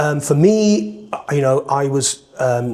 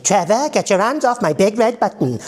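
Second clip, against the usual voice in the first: higher, bossy store manager; Stacey telling Trevor off in the advert; 7 semitones higher than usual. Shown as a demonstration. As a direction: scold Trevor like a shop manager. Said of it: "So it was like".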